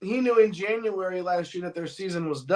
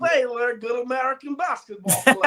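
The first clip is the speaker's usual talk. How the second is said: put-on Borat accent; 8 semitones higher than usual. Co-host reads a fan's comment in a hammed-up Borat accent. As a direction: belt it out like Borat praising an American player. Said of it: "like, a Borat accent in my head".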